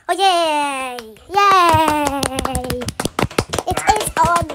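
loud happy yay, real delight; excited cheer. Real, not acted. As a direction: cheer it out, thrilled. Happy the recording is on.

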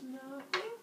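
high, soft fake innocence; sheepish high voice with a rise; innocent Kronos. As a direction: play it small and high, caught red-handed. Kronos caught, feigning innocence.

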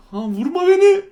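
high, loud cry begging not to be stabbed; panicked plea. Real, not acted. Gamer begging the game killer, high panicked plea. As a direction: yelp it out as the knife comes. Killer swinging knife at him.

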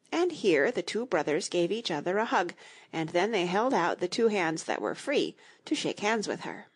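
usual speaking voice

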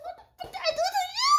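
high, laughing cry of disbelief; real laughing outrage. Real, not acted. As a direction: laugh it out, half crying. Reacting to friend's long text.

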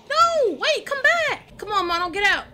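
loud pleading calls; urgent calling. Real, not acted. Calling to companion in danger.

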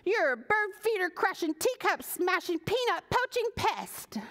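high-pitched angry scolding; scolding rant. Acted. Pearl scolding the pesky squirrel, high and angry. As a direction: rattle off the insults, fuming.

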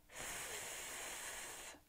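"F" as a long voiceless hiss of air, not the letter name; isolated f sound. Shown as a demonstration. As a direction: hold "F" as pure friction, lip against teeth. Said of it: "Let's try saying the f sound".

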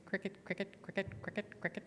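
very soft, whispered cricket chirps. Shown as a demonstration. Preacher imitating silent crickets in a quiet church, whispered. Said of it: "you hear the crickets".

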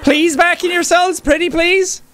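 high sweet begging voice; pleading prison guard. Acted. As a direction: coax them back, pretty please. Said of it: "pleading prisoners back".

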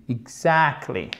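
"Exactly" said slowly, stressed on "ac"; teacher's slow stress demo on "Exactly"; stress on second syllable. Shown as a demonstration. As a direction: draw out "Exactly" to show the stressed middle syllable. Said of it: "L'accent tonique est ici".

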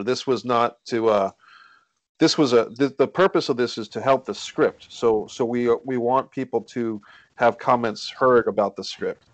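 ordinary talk, nothing marked

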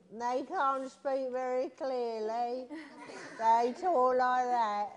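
put-on slow, wavering old grandma voice; drawn-out cartoon granny. Shown as a demonstration. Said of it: "That's my grandma voice".

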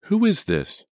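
high, loud, alarmed challenge; sharp, high-pitched demand. A narrator's reading. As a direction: demand the caller's name. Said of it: "Jenny demanded".

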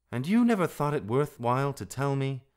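cross disbelief; indignant. A narrator's reading. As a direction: reproach with rising exasperation. Rikki indignant at late news.

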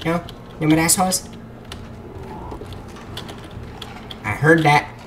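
hushed mock Chinese accent; Jackie Chan accent. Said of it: "I always slip into an accent".

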